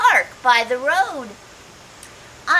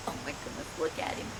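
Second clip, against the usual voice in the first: whispered and much softer; hushed whisper; 8 semitones lower, 15 dB quieter, much faster than usual. Real, not acted. Pointing out the puffed-up toad to children, whispered. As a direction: lean in and whisper it.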